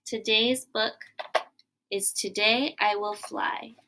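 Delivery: normal talking voice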